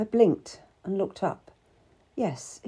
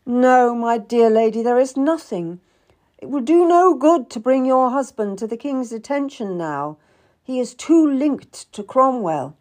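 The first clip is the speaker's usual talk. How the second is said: loud, emphatic, higher refusal; firm refusal; 5 semitones higher, 8 dB louder than usual. A narrator's reading. Seymour turns down the pleading wife, firm. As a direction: shut down the plea firmly.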